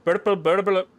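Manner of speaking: loud, high mock Scottish, tongue-tied; fumbled loud Scottish accent; botched Scottish accent. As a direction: stumble through a loud fake Scottish. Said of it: "I can't even say it".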